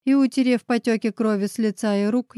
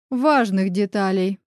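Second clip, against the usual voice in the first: usual speaking voice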